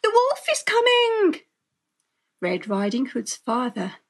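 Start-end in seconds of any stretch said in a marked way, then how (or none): 0.0-1.4 high, loud alarmed warning; urgent girlish shout; crying out. A narrator's reading. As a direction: raise the alarm as a panicked girl.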